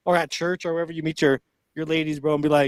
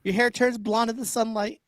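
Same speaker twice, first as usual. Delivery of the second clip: scolding woman voice; 6 semitones higher, 3 dB louder than usual. Acted. Mocking Kamala scolding.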